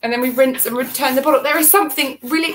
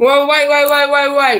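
urgent call; 4 semitones higher, 7 dB louder than usual. Real, not acted.

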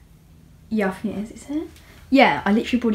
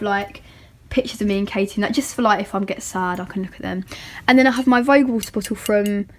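usual speaking voice

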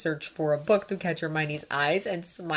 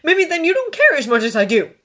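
loud, raised, accusing retort; angry; 11 semitones higher, 10 dB louder than usual. A narrator's reading. Draco storming off from Hermione, angry. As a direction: hurl it as a parting shot. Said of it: "Draco returned angrily".

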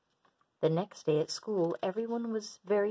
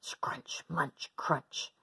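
breathy whispered munching sounds, like a mouthful of tin cans; whispered crunching; 3 semitones lower, 4 dB quieter, much slower than usual. A narrator's reading. Fish munching junk food.

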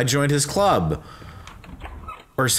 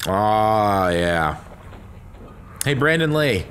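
usual speaking voice

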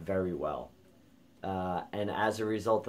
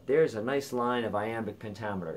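ordinary delivery

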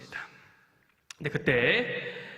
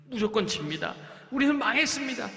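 scared spy; 8 semitones higher than usual. Acted. Spies' fearful report.

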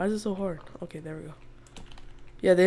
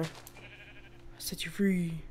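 slow breathy whisper to the sheep; whispered mock release; whispered aside; 11 dB quieter, much slower than usual. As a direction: hush it out slowly, as if freeing a pet.

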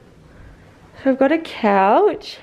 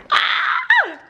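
excited shriek. Real, not acted. Sees her new house.

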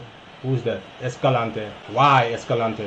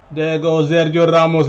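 ordinary talk

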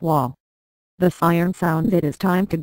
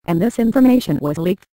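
usual speaking voice

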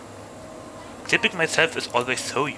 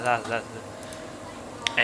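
slow altered voice; slow processed singsong; voice changer; 2 semitones lower, 7 dB quieter, much slower than usual. Shown as a demonstration. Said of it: "voice changers".

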